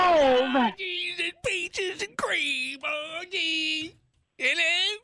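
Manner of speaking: hammed-up prospector; grizzled prospector. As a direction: stretch it out like a wheezing miner. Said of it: "impression of the old grizzled prospector".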